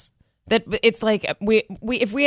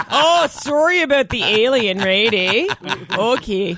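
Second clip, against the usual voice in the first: loud, apologetic Canadian caricature; hammed-up Canadian sing-song; Canadian accent; 3 semitones higher, 4 dB louder, slower than usual. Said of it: "smaller parts as Canadians".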